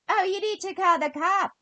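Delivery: high, put-on voice of a dismissive official; mocking the agencies. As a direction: mimic a condescending caseworker.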